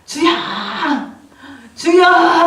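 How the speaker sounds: long-drawn cry to God, swelling to loud; crying out in prayer. Retold or imitated. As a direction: re-enact a prayer shout, stretching the vowel and building. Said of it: "막 소리를 질렀어요". (길게 끌며 주님께 부르짖는 기도 흉내)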